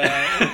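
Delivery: loud helpless laughing; burst of laughter. Real, not acted. Co-host cracking up over the name mix-up, laughing loudly. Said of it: "laughter after the name".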